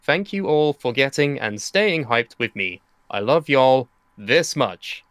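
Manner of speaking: slow mock-heartfelt reading. Reading wrestler's farewell tweet.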